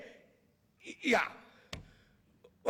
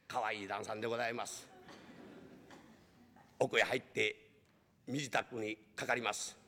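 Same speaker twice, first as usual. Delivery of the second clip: lower, soft storyteller aside; lowered narrator's voice, commenting fondly on the master; 13 semitones lower, 6 dB quieter than usual. A narrator's reading. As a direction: step out and comment dryly.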